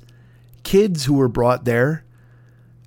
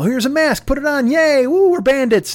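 put-on higher, louder, bubbly sell to a toddler; cheery parent voice; 10 semitones higher, 4 dB louder than usual. Parent hyping up a mask for his three-year-old, bouncy and excited. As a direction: make the mask sound like a fun game. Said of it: "have that talk with your three-year-old and go".

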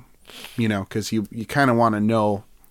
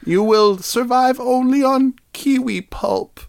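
lifted, solemn prophecy in a higher voice; high mock oracle voice; 10 semitones higher than usual. Mother Earth decrees the kiwi diet to the druid, in a high solemn mock voice.

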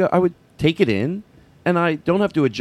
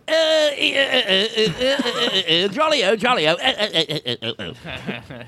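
high, far-off "eh, eh, jolly-o" with a laugh; Trent doing the impression; 8 semitones higher than usual. Friend drives up mimicking the comic's own bit, high. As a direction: chirp it out high, then fade and laugh. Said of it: "and he goes".

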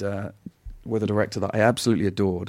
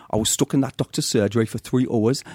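broad Newcastle dialect; Geordie accent. Shown as a demonstration. Nan telling Gavin about the doctor's, Geordie accent. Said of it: "And it goes like this".

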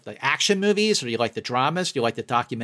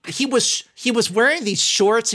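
excited; 10 semitones higher than usual. Real, not acted. Telling co-host about the surprise guest, excited and higher. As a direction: gush about the surprise on stage.

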